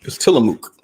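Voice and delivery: breathy close-mic whisper; ASMR whisper. Pretend. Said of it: "He did the A S M R".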